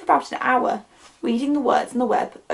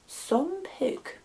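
soft, awed murmur of the words; 6 semitones higher, 8 dB quieter than usual. A narrator's reading. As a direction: murmur it under your breath, much softer. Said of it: "Then he murmured the words".

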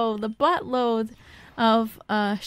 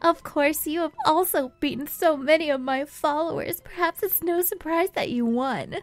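higher-pitched, creepy villain speech; 5 semitones higher than usual. Acted. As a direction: voice a creepy, self-assured game villain. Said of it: "That's why I'm giving him a creepy voice".

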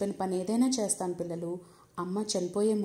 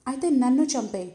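put-on a child boldly offering, higher and louder; proud bold offer; 4 semitones higher, 7 dB louder than usual. A narrator's reading. Shraddha boldly telling God to take her, proud and loud. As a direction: declare it like a grand brave deed. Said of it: "అదేదో పెద్ద ఘనకార్యం అన్నట్లు".

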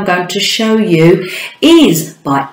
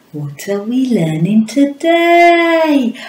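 normal talking voice

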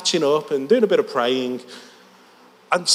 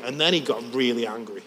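ordinary talk

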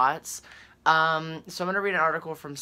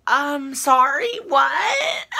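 high, loud laugh-cry; half laughing, half crying in shock; a squealing laugh on the edge of tears; laughing near tears; 11 semitones higher, 6 dB louder, much slower than usual. Real, not acted. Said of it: "I'm trying not to cry".

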